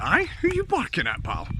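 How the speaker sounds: high mocking Scottish taunt; mock tough Scot. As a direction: taunt the dog, high and cheeky. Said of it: "You'd be like".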